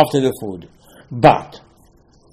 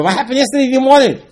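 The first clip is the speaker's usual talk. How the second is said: mock incredulous Moshe; 11 semitones higher, 4 dB louder, faster than usual. Moshe questioning the complaining people.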